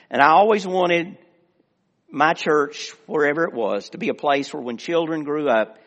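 normal talking voice

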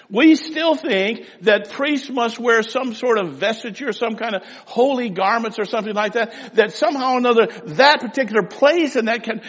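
loud, rising preacher build; full-force sermon climax; fiery preaching. Acted. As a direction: preach it hard to the congregation.

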